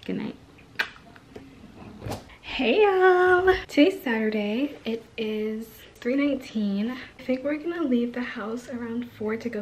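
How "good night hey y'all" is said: normal talking voice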